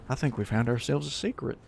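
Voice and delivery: put-on slipping into a soft southern accent. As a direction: let a hint of southern accent creep in. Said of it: "I don't know why I'm in a southern accent".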